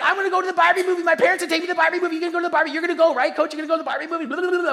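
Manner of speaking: high, loud, breakneck mock-teen babble; excited teenage athlete. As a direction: gush nonstop like an overexcited teenager. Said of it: "this is my teenage, any teenager, mouth full of braces".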